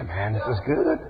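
soft, low laughing sigh; laughing contentment. Retold or imitated. Believer drinking from the river, softly laughing. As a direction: savor it with a chuckle.

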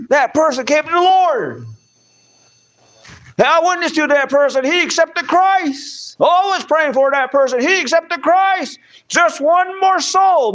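rejoice over a soul won, stretching the last words; excited testimony. Acted. Believer rejoicing over someone who came to the Lord, excited. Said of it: "man, you get excited".

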